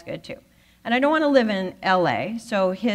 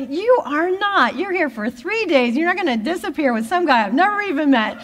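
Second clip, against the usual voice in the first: stern friend; 9 semitones higher, 6 dB louder than usual. Retold or imitated. Friend Tamara refusing the stranger's ride, mock scolding voice. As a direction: play the bossy friend saying no way.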